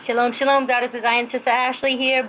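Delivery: ordinary talk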